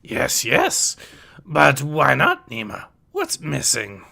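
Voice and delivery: put-on wise old teacher with swooping pitch; Yoda's voice. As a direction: question the pupils as the old sage.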